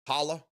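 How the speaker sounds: Holla with a broad ah, rising; other pronunciation. Shown as a demonstration. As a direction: try Holla as "HAH-luh". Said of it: "we pronounce it Valhalla up here".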